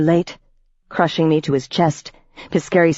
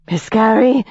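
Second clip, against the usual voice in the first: higher, begging call of his name; pleading; 6 semitones higher, 3 dB louder than usual. A narrator's reading. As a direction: beg him to stop. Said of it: "Ivy pleaded".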